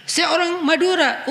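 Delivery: mocking a proud excuse; mock boast.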